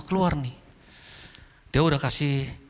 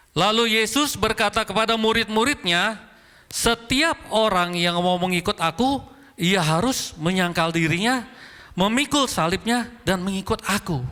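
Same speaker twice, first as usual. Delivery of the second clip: slow, deliberate Bible reading, pitched up; scripture reading; 5 semitones higher than usual. Real, not acted. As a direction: read the verse out clearly for the congregation. Reads scripture aloud to congregation.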